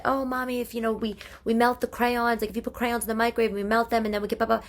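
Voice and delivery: put-on high, quick chattering child; kid voice. Mother imitating her daughter explaining crayon crafts, quick high kid voice. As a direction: rattle it off like an eager kid.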